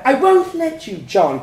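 very loud, high shouting; furious shout. A narrator's reading. Parents shouting at each other over the child. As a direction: blast it across the table.

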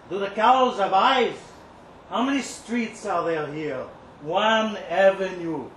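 ordinary delivery, nothing marked

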